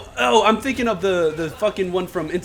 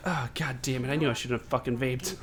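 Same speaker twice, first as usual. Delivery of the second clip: rueful muttering; 5 semitones lower, 11 dB quieter than usual. Pretend. Regretful addict.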